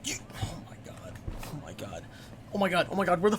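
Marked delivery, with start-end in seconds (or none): 0.1-2.1 whispered oh my God under his breath; exasperated whisper. Real, not acted. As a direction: breathe it out, fed up and nearly silent. Frustrated after stream interruption.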